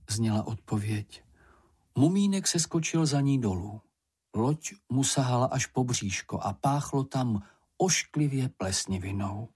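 ordinary delivery, nothing marked

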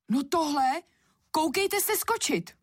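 high-pitched cross command; indignant girl. A narrator's reading. Anička stamps and orders the slippers, high and indignant. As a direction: order them about like a stamping little girl. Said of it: "dupla Anička bosou nožkou".

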